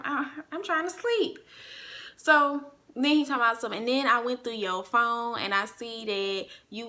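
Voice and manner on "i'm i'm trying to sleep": high, stumbling sleepy complaint; whiny protest. Retold or imitated. Drunk girlfriend wanting sleep during the argument, whiny protest. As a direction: plead to be left alone, pitched high. Whining at being kept awake.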